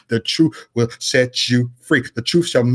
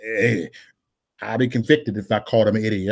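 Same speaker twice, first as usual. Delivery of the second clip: ordinary delivery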